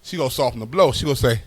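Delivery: ordinary talk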